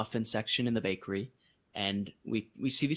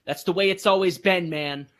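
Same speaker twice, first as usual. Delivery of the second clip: loud, higher imitation of a rude manager; mock rude interjection; 4 semitones higher, 7 dB louder than usual. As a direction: butt in like a dismissive manager. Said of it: "He was super rude to me".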